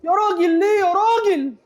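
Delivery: high, loud cry of protest; anguished protest. Real, not acted. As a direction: wail why at the screen. Protesting the referee's call. (صرخة احتجاج عالية)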